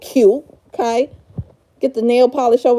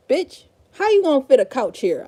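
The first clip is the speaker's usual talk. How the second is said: ordinary delivery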